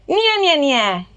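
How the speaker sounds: bright nasal nya; nasal twang nya; twangy nya. Shown as a demonstration. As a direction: pinch it into twang. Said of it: "just give me".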